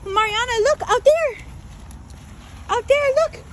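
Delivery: urgent, delighted "look over there" voice; excited pointing-out. Real, not acted. Pointing things out to a child.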